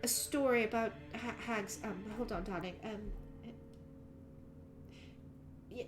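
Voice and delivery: hesitant, halting mum voice; flustered mother. Acted. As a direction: dodge the question nervously. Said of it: "bizarre look and says".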